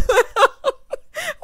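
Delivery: loud, high burst of laughter; high shrieking laugh. Real, not acted. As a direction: cackle at the joke. Laughing at own joke.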